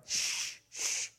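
hushed shush. Retold or imitated. Wife shushing husband at the game, hushed. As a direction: shush them quietly. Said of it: "I went".

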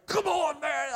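high poolside yell; yelling encouragement. Retold or imitated. Dad yelling at kids swimming, high and urgent. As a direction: cheer them on from the side of the pool. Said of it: "And I'd yell".

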